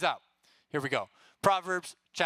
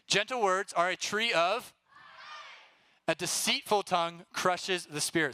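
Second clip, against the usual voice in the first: fill-in-the-blank reading; 5 semitones higher than usual. Shown as a demonstration. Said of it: "here we go, ready?".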